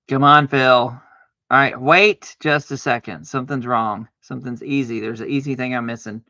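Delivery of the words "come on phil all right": ordinary delivery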